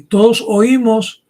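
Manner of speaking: high, loud and slow; slow, swelling preacher's cry; emphatic preaching. Real, not acted. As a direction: stretch it out loud and high with wonder. Preacher building intensity.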